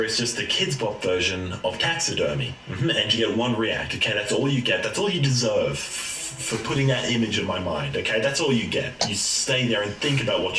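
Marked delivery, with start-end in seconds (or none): none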